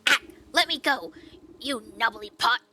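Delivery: high, loud squealing creature; tiny creature begging to be let go; squeezed pest. Acted. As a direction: squeal as if crushed in a fist.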